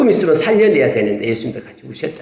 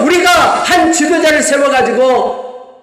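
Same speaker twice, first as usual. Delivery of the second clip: slow, high shouted call to go back; rally the crowd with a slow shout; rebellious shout; 9 semitones higher, slower than usual. Retold or imitated. Preacher voicing the rebels calling to return to Egypt, shouting. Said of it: "그러면서".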